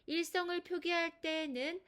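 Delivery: ordinary talk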